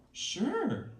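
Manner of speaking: mock-flirty reply. Woman answering a caller, lightly flirty. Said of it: "you reply in your best flirty voice".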